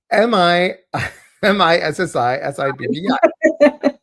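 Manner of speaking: spelling it out through laughter; laughing. Real, not acted. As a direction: giggle while spelling out letters. Jokey Mississippi spelling gag.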